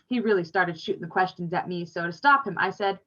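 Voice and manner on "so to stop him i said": ordinary delivery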